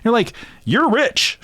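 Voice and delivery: normal talking voice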